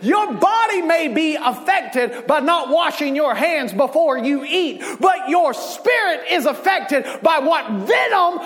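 fiery slow sermon shout; preaching at full force. Acted. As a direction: drive the point home to the congregation.

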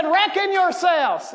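put-on a light Southern accent, spoken slowly. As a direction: say it like a Southern country preacher. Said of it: "He was southern".

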